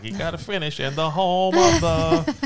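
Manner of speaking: high, loud and drawn out; Sheldon. Acted. Admits to being OCD, high insistent Sheldon take-off. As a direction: hold it out slowly, insisting on the ending. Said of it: "I'm like Sheldon".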